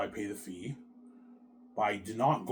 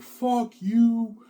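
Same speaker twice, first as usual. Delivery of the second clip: mock crying wife; 9 semitones higher, 7 dB louder, slower than usual. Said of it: "she's crying to me".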